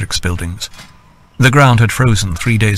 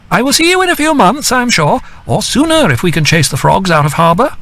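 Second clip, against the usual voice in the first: high, light female voice calling down; Edith calling down; 10 semitones higher than usual. A narrator's reading. Edith calling down a cheerful farewell from atop Excidium. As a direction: call down cheerfully to him from dragon-back. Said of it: "she called down".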